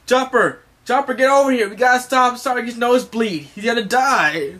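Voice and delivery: high, loud, urgent holler; panicked calling. Acted. Usopp calls Chopper to save Sanji, urgent shouting. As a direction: yell for the doctor in mock panic. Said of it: "he's calling Chopper like".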